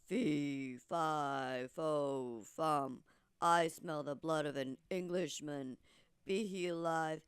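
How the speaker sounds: deep, booming, slow giant. Acted. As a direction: rumble it out like a huge menacing giant. Giant sniffs and speaks.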